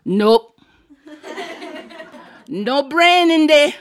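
loud, drawn-out aunt's verdict; mock-inspecting aunt. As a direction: announce the finding loudly and slowly. Aunt inspecting child's ear, acted.

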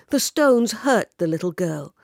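ordinary delivery, nothing marked

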